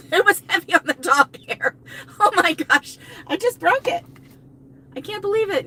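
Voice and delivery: high, loud, breaking up laughing; laughing disbelief. Real, not acted. Woman explaining broken duster, high laughing disbelief.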